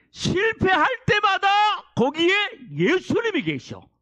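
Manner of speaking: high, loud preaching climb. Real, not acted. Pastor rousing the congregation, loud high preaching. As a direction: lift the room with rising fervor.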